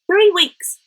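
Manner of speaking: high, loud and proud; eager boy voice. Acted. Roger blurting his age to the head, high and eager. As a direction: blurt it out like a pleased child.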